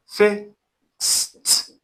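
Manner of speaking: Fixed drawn out, high and careful; fixed said carefully. Shown as a demonstration. Teacher draws out fixed for students to note. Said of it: "take note, this is fixed".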